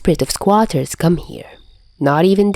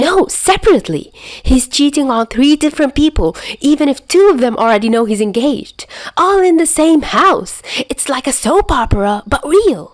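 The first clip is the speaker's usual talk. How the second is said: high, breathless gossip voice, over-eager; a wired friend spilling juicy gossip; high-pitched, giddy storyteller; hyper gossip; 9 semitones higher than usual. Acted.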